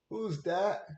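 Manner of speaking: high, loud call across the house. Real, not acted. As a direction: call out to someone in another room. Dad calls out to child.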